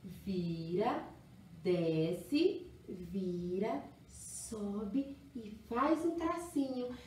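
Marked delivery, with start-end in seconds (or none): none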